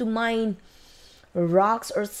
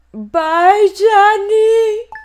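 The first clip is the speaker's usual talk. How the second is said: loud sing-song farewell; 11 semitones higher, 9 dB louder, much slower than usual. Acted. Waving off sold pet.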